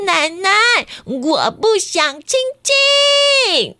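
put-on high, slow little-girl voice; small chick refusing. Chick tells grandma she won't kiss, high and drawn out.

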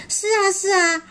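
high, keen agreement; relieved storybook yes-yes; eager reply. Acted. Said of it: "巴尔也赶紧回应了".

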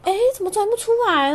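high, fast puzzled voice of a tourist; high-pitched bewildered quote; confused tourist. Retold or imitated. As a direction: voice the lost Japanese tourist at the jammed dispenser. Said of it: "他们是弄日文说".

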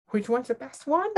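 high, rising disbelief on the edge of laughter; incredulous echo. Retold or imitated. Clerk thrown by customer's question, incredulous echo.

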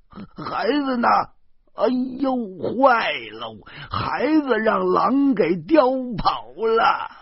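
high, wailing cry of alarm; panicked scream. A narrator's reading. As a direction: scream in panic for a lost child. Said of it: "啊的一声大叫". (惊恐地尖声大叫)

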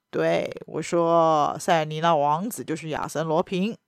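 a low, smug reply from the baron; lightly voiced, mocking baron; amused baron. A narrator's reading.

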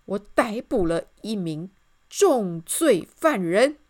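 loud, slow proclamation; soldier's report. A narrator's reading. Soldier reports his arrest to the king, loud proclamation. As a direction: announce it in heavy, separated words.

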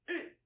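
"Mm" said very short and fast; a quick, cut-short "Mm" as a tone sample; short fifth tone. Shown as a demonstration. Teacher showing how the fifth intonation sounds, short and fast. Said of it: "The fifth intonation sounds like this".

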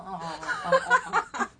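rising, laughing mock bafflement; baffled oh. Her stunned reply to the friend, laughing. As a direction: act dumbfounded. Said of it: "我说".

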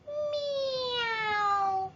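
high, long drawn-out meow; cat meow. Acted. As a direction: meow like a storybook cat, sliding gently down. Cat enters the story.

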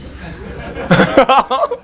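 rising high laughter; real laugh. Real, not acted. Laughing after the story.

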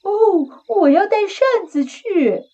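a high, chirpy critter announcing a plan; squeaky little animal. Acted.

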